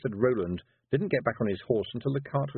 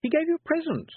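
put-on prying old lady; 4 dB louder than usual. Miss Tick prying.

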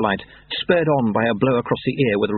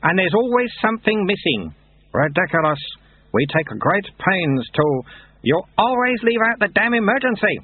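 put-on higher-pitched, indignant old hand at drills; exasperated Lutze; 11 semitones higher than usual. Lutze scolding workers over emergency drills, exasperated. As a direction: voice Lutze as a fussing taskmaster.

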